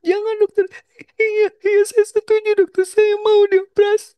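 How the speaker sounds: imitating a mother pleading while crying; crying mother begging. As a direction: beg through tears to spare her child. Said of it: "Sambil nangis".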